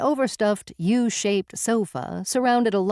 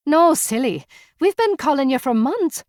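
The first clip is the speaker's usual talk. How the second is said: higher and louder, bubbly; playful teasing; 8 semitones higher, 5 dB louder than usual. Acted. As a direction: tease her brightly. Bubbly woman teasing Cora.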